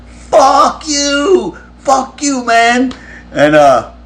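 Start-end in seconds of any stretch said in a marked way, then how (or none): 0.1-2.9 taunting yell. Retold or imitated. Friend taunting the angry bandmate through a locked van door, gleeful mock yell.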